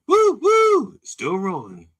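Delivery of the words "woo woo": high loud train-whistle woo woo. Shown as a demonstration. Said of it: "that train is still rolling".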